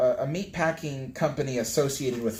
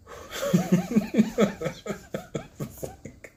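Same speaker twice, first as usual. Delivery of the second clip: long squeaky burst of laughter; cracking up at the struggle; high giggle. Real, not acted. Giggling at her own struggle.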